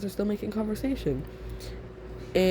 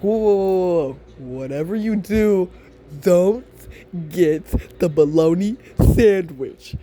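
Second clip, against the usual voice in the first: urgent warning; 7 dB louder than usual. Acted. Everybody warns you off the bologna sandwich, urgent warning. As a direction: warn them off loudly, dragging out the last words.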